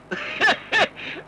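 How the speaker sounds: a breathless laughing fit; burst of laughter. Acted. Nephew laughing at his uncle's word, a laughing fit. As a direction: crack up helplessly.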